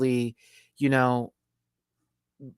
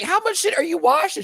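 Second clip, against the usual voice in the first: incredulous question; 6 dB louder than usual. Retold or imitated. Retelling a laundromat question.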